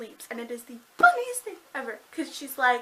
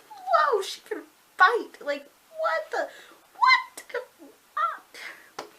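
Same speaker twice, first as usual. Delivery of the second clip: high, loud, replayed astonishment; amazed outburst; 5 dB louder, much slower than usual. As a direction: squeal it in hammed-up disbelief. Reenacts own amazed reaction to fight.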